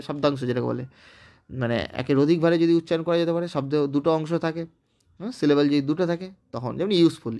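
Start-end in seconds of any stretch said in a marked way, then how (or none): none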